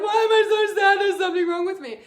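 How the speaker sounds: hammed-up self-pity, half shouted; mock despair. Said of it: "When we're sad, we're like".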